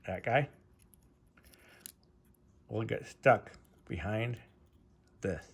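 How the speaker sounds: talking around a flashlight in mouth; muffled words, object held in mouth; mouth full. Real, not acted. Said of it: "I had a flashlight in my mouth".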